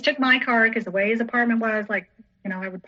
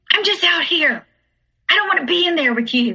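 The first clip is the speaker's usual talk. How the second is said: raised, higher-pitched retort; retold irritated yell; 6 semitones higher, 4 dB louder than usual. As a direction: snap back defensively from another room. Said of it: "yelling for him".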